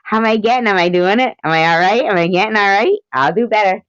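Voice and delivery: keen to know if it works; eager checking in. Real, not acted. Streamer asking chat about the accent, eager.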